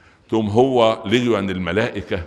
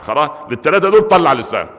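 ordinary delivery

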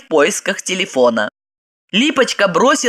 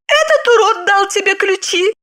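high-pitched stern interrogation; 13 semitones higher than usual. A narrator's reading. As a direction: question sternly in a high woman's voice. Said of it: "Она строго воззрилась". (строго, высоким голосом)

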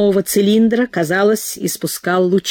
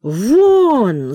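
furious bellow; 3 semitones higher than usual. A narrator's reading. Prokhor roars at Cook to get out, angry. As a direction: throw him out with one word. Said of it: "заорал взбешённый Прохор".

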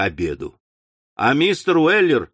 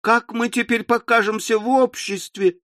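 despairing lament; 7 semitones higher than usual. Acted. Daughter lamenting.

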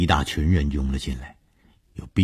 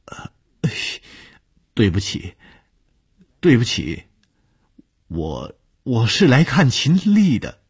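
timid apology; 7 semitones higher, 4 dB louder than usual. Acted. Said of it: "他慢吞吞地说".

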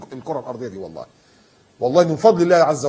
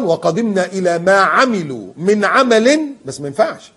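1 slow recitation; 4 semitones higher, 7 dB louder, much slower than usual. Real, not acted.

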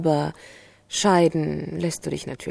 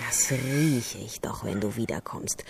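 bitter whisper; 7 semitones lower, 8 dB quieter than usual. Acted. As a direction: let the accusation sink into a hiss of disgust. Hurt accusation sinking to whisper.

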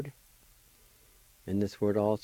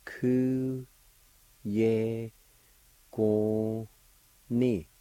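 each syllable held long and separate: Ku, ye, go, ni; much slower than usual. Shown as a demonstration. Teacher showing learners the word for July, syllable by syllable. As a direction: sound out "Ku ye go ni" with clear gaps. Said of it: "it's pronounced like this".

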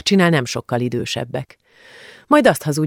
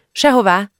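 a high, quick snap of an answer; girl answering the aunt too quickly, a high blurt; 6 semitones higher, 3 dB louder than usual. A narrator's reading. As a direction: blurt it out too fast, suspiciously. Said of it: "gyanúsan gyorsan vágta rá".

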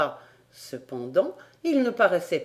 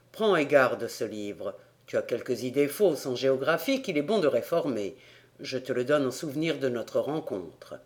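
kindly gentleman; 6 semitones lower than usual. Acted. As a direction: speak low and gently to a child. Glenarvan gives the boy a book. (voix basse et bienveillante)

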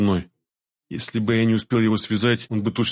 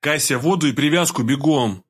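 slightly raised, hurried command; urgent order; 4 semitones higher than usual. A narrator's reading. Doctor orders Kasya to fetch supplies, urgent near-yell. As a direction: send her running for supplies. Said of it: "Опять чуть ли не орал я".

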